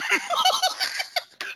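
high breathy burst of laughter. Real, not acted. Host cracking up at her own joke.

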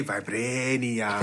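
mock African accent. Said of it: "when I'm hearing man say".

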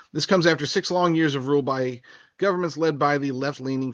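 usual speaking voice

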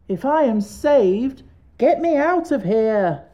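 high, slow pumpkin-head call; Jack calling out. Acted. Jack calls to Tip from beneath sofas, high slow plea. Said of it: "called Jack".

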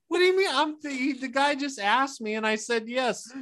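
a high, hammed-up plea of innocence; mock indignant protest. Retold protest.

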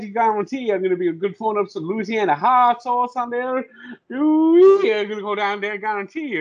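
high, excitable Cajun chef impression; Justin Wilson. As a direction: whoop and ramble like a Cajun cook. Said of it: "was like the Cajun chef".

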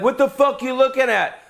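high, mocking snap; tattooed guy snapping back at a starer, mocked high; mock hostile retort. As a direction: play the touchy tattooed guy. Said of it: "Don't be like".

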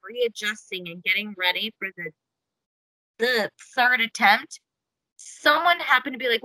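ordinary talk

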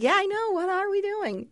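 high, playful echo of his outrage; mock agreement. Retelling how she echoed Adam's complaint back to him, in mock agreement. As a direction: chime in high as if fully on board. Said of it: "And I'm like".

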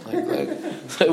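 ordinary delivery

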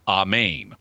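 AMEN as "ah-MAYN", long ay in the second syllable; Greek pronunciation. Shown as a demonstration. Host sums up the Greek spelling, AMEN said ah-MAYN. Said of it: "which is a long A".

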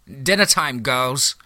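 high, loud screech; a siren shrieking at her sisters. A narrator's reading. Siren screeches to the other sirens, calling them to the feast. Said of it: "screeched out".

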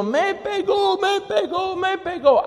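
put-on high childish wail; a child complaining he was hit; whining child. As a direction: whine like a hit kid. Hurt child complains to mother.

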